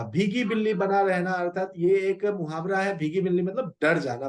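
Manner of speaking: normal talking voice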